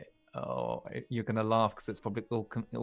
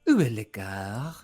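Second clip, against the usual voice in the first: slow, loud, drawn-out sultry French; sensual tape woman; 7 dB louder, slower than usual. Retold or imitated. Retelling the French class tape, mock sensual. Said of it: "in a really sensual way".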